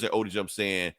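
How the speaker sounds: usual speaking voice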